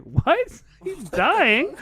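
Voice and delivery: high-pitched incredulous retelling; shocked disbelief. Retold or imitated. Friend retelling how he heard Noah was dying, shocked. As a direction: laugh through mock shock at the news.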